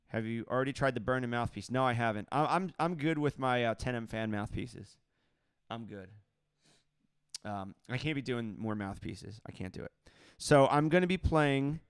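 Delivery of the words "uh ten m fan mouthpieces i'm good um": ordinary delivery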